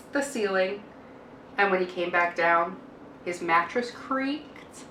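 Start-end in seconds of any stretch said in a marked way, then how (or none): 3.2-4.8 slow narration. A narrator's reading. Storybook sound effect narration.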